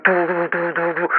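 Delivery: soft "doo doo" guitar riff; imitated rock guitars. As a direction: voice a guitar riff with your mouth. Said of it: "rock guitars are going".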